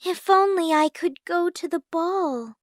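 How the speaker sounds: high, light longing voice; dreamy, plaintive sigh of a line; wistful. A narrator's reading. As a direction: wish it to yourself. Cinderella wishes to herself.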